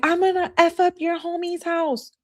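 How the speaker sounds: high mocking impression of the girlfriend. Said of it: "She's like".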